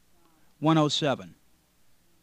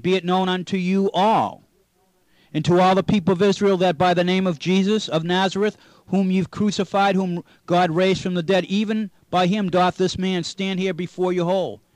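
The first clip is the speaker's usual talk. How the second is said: scripture reading; 2 semitones higher, 6 dB louder than usual. Acted. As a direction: read the King James verses out like from a pulpit.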